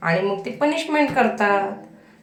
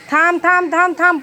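higher, louder urgent call; calling out stop; 6 semitones higher, 5 dB louder than usual. Real, not acted. Mother calling her son away from the parking ramp, urgent shout.

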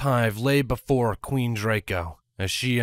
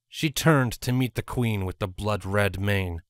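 usual speaking voice

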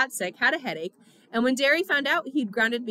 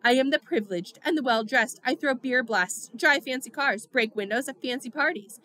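ordinary delivery, nothing marked

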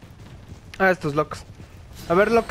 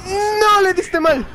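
high, sharp cry of dismay; 9 semitones higher, much slower than usual. Real, not acted. Gamer yelling at his character's miss, high dismayed cry. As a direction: yelp at a missed hit.

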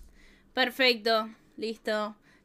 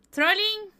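high rising disbelief; trolling asked high and rising; surprised question; 8 semitones higher, 4 dB louder than usual. Real, not acted. Disbelieving repeat of the word.

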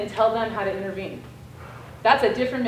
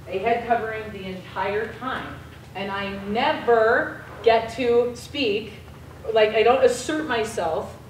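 normal talking voice

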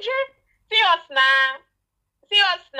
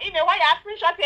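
ordinary talk

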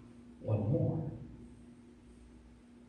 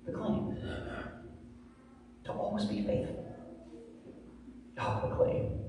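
hushed, dramatic whisper; hushed preacher's whisper; 5 semitones higher, 12 dB quieter than usual. Acted. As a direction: breathe it to the congregation.

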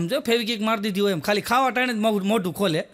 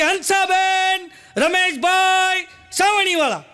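high, slow, drawn-out shout; loud shouting; 8 semitones higher, 4 dB louder, much slower than usual. Retold or imitated. Neighbour shouting names at the door at night, loud and drawn out. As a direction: bellow the names outside the door. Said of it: "એટલું બધું જોરથી બોલ્યું ને".

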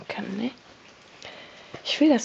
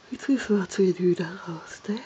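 ordinary delivery

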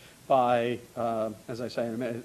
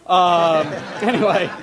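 laughing; 4 semitones higher, 9 dB louder, slower than usual. Real, not acted. Laughs at the editors' snub.

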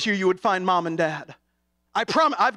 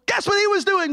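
puppet voice; 11 semitones higher than usual. Retold or imitated. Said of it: "his puppet Gabby".

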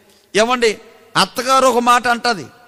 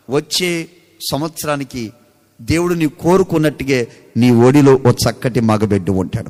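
gentle comfort; 7 semitones lower than usual. Retold or imitated. Man of God comforts her.

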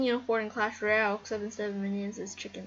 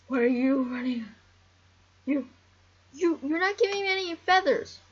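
pitched way up, loud and accusing; high, loud mock outrage at the chickens; indignant scolding; 3 semitones higher, 7 dB louder than usual. Real, not acted.

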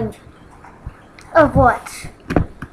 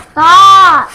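loud shout; 3 semitones higher, 11 dB louder than usual. Real, not acted. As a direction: yell stop. Repeated stop getting louder.

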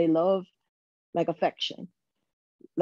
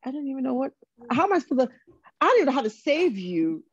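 higher, helpless bewilderment; raised pitch, exasperated; flustered disbelief; 6 semitones higher, faster than usual. Retold or imitated. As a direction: at a loss for words. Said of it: "I was just like".

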